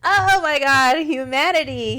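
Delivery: loud excited exclamation; high and loud amazement. Real, not acted. Laughing exclamation.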